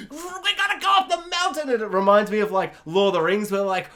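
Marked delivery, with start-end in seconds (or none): 0.1-1.7 much higher, fast desperate cry; storm-battling dad. Retold or imitated. Dad leading family through a storm, high and urgent. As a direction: shout it over an imagined storm.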